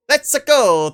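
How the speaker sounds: high, loud plumber catchphrase; Mario catchphrase. Acted. Gamer playing Mario hits the switch, high excited call. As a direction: call it out like a cartoon plumber setting off.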